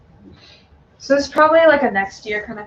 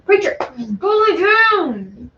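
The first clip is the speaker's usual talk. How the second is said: raised, drawn-out order to the dog; loud stern command; 4 semitones higher, 4 dB louder, slower than usual. Real, not acted. Owner ordering her dog away from the puppies, loud stern shout. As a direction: bark the order so the dog obeys.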